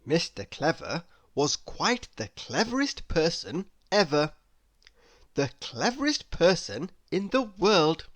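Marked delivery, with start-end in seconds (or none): none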